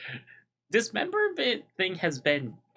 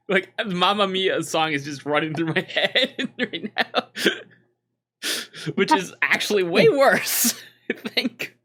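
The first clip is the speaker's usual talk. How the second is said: laughing; 3 semitones higher than usual. Real, not acted. Cohost laughing at her own joke.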